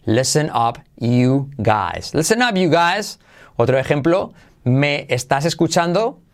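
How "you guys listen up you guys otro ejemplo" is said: ordinary talk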